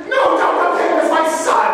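put-on high, loud plea of a frightened old lady; panicked plea. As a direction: beg them not to take him. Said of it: "the little old lady shrieks".

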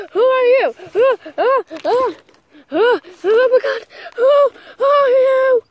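high, loud and stammering; a frightened cry at the stranger; panicked. Acted. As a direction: demand it in terror, voice cracking. Facing the creature in fear.

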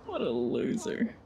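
low, slow put-on sneer; mock scorn. Mocking the hologram guy, low slow sneer. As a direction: drop the voice and sneer at him.

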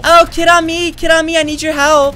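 man doing a woman's voice. Acted. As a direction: girlfriend voice, urgent in game. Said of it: "This is my voice".

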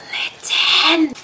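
hushed delighted gasp of a name; awed whisper. Real, not acted. First sight of the handmade Litten doll, awed whisper. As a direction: breathe the name in awe.

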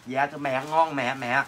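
low, soft mock-dutiful answer; sarcastically humble murmur; mock-deferential reply. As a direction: drop low and quiet, feigning meek obedience.

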